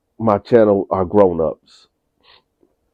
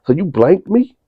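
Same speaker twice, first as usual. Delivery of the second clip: much higher, rising disbelieving question; shocked mother; 10 semitones higher than usual. Retold or imitated. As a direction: redo it with the mother's stunned pitch. Mother accusing son, redone higher.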